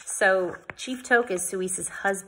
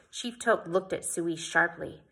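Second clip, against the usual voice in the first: ordinary delivery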